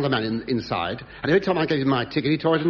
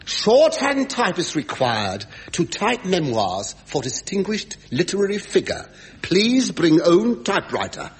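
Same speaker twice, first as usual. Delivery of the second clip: slow, deliberate small-ad reading; advertisement read-out; 3 semitones higher, 3 dB louder, slower than usual. Acted. Comic quoting his advert for a typist, slow formal read-out. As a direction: spell out the advert word by word. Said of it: "I advertised for a woman".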